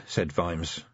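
usual speaking voice

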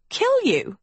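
high, falling wounded disbelief; hurt. A narrator's reading. Sophie answering an accusation of murder, hurt. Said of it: "Sophie looked hurt".

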